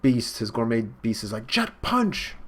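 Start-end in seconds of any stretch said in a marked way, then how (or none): 1.5-2.4 high mock battle call; acted attack call. Gourmet beast launches its attack, acted high battle call.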